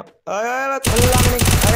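high, loud cry of alarm; real panicky gamer shout. Real, not acted. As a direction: yell out urgently to stop someone in the game. Alarmed shout during live gameplay.

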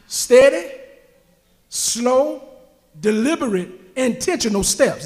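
one word at a time, long pauses between; slow, deliberate steps. Shown as a demonstration. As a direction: step through the words unhurriedly.

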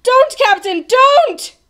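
high, loud shout rising to a shriek; desperate warning. A narrator's reading. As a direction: scream to stop someone in time. Bartholomew desperately stops the captain.

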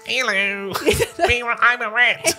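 high laughing rat squeak; squeaky rat voice. Acted. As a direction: play the little rat, high. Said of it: "It's the same voice".

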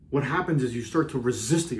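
ordinary delivery

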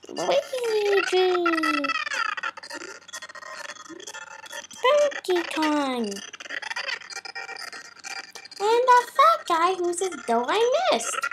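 high chipmunk voice; sped-up chipmunk voice. Real, not acted. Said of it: "I'm speaking like a chipmunk".

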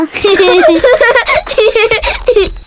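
teasing laugh. Acted. Laughing at a fierce teacher.

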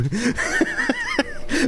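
very high-pitched, wheezy laughter; helpless high giggle; squealing laugh. Real, not acted. Laughs at own barbecue exclamation.

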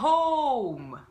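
a vocal coach modelling a word; English O in own. Shown as a demonstration. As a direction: own very slow and loud with a long rounded O gliding to U. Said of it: "例えばこのオーが入っている単語".

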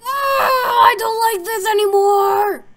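loud, high, drawn-out shout; frightened wail. Real, not acted. Player has had enough of the game, frightened wail. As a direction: wail it slowly in dread.

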